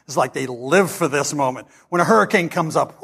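ordinary talk

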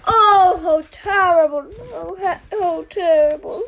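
hammed-up crying owl. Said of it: "Owl began to cry".

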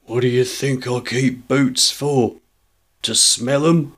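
thick-voiced tradesman starting to get angry; sarcastic shopkeeper, anger still held in; thick husky shopkeeper. A narrator's reading. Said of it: "in a thick and husky voice".

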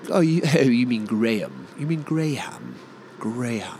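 mock English speaker; English accent, sounding Graham as Gray-ham. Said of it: "And the English".

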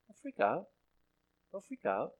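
softly calming, gently; calm reassurance. Acted. To a panicking America, calm reassurance.

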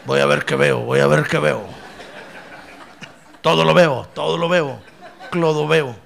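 parodia de médium en trance, con risa; burlón, como vidente que mira lejos; seance medium. Pretend. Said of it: "No crea usted que me pongo así en trance". (médium en trance de broma)

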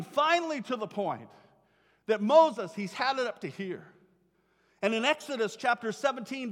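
ordinary talk

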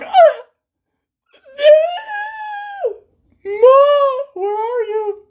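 very high wailing cry for mom; mock crying shriek. Teen hamming up crying for his mom after the coach's truth. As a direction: wail like a hurt little kid. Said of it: "And I was like".

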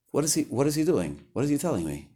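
usual speaking voice

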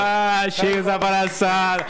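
slow exaggerated loud outburst; hammed-up fed-up yell, drawn out; raging gamer. Mock outburst ending the joking.

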